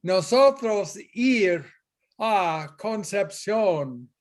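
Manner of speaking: put-on slow, deliberately broken American-accented Spanish; clumsy American accent in Spanish; mock gringo Spanish. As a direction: play a tourist who can't conjugate, slowly. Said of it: "like we didn't know Spanish".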